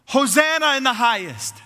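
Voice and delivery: high, ringing crowd acclaim; crying out. Retold or imitated. The people greeting Jesus as he rides in, crying out. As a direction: cry it out to celebrate a king's arrival. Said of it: "and they cry out".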